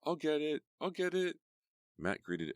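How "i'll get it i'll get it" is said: put-on high, loud eager kid voices; eager calling. A narrator's reading. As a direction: twins racing to the door, shouting. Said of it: "each calling".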